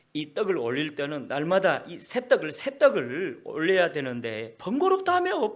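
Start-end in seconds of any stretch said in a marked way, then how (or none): 4.6-5.5 mock whine. Priests mocked as complaining.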